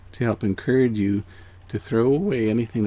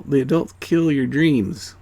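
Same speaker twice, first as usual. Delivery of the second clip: ordinary talk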